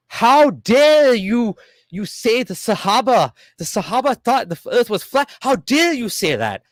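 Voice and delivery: high, outraged mock sheikh; indignant sheikh. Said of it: "He got mad at me".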